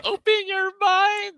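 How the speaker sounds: high, loud, drawn-out croon; Morty's sidekick catchphrase. Retold or imitated. Hosts quote the alien sidekick's catchphrase, high drawn-out mimicry. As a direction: stretch it out in a loud, high character voice. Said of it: "he just keeps saying".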